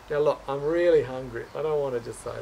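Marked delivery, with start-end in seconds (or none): none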